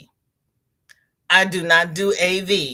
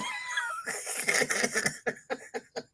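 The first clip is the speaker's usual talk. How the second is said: wheezy laugh; 10 dB quieter than usual. Real, not acted. Laughing at herself.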